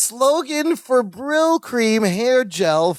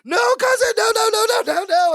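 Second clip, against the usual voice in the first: high-pitched frantic retold yell; panicked mock yell. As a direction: cry out to stop him, pitched way up. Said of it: "Balki yells".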